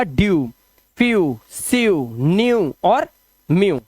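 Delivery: each word ending in a drawn "yoo", like फ्यू; ew drill. Shown as a demonstration. Teacher drilling ew words, ew drill. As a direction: glide into यू on every word. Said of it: "E W मिलकर बनेगा".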